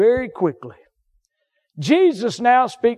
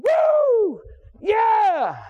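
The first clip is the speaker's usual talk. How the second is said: revival whoop; 13 semitones higher than usual. Retold or imitated. Recalling revival high.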